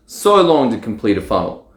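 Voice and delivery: normal talking voice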